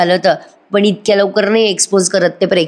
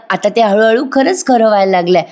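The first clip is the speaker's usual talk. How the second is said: ordinary delivery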